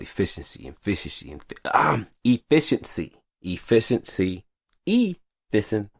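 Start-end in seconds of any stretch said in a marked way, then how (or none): 4.9-5.9 slow high stumble on "efficient"; fumbled word. Real, not acted. Tongue-tied trying to say efficiency, slow high stumble. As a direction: drag out "efficient" as the tongue fails. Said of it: "I can't say that shit three times".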